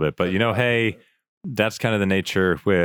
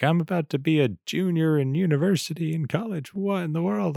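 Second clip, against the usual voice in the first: higher-pitched incredulous quote; mock disbelief; 9 semitones higher, 3 dB quieter than usual. Host voicing students amazed at being juniors already, mock disbelief. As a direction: imitate a student who cannot believe it.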